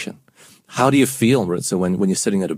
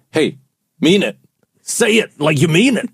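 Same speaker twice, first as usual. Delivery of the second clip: demanding; 4 semitones higher than usual. Acted. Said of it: "don't go".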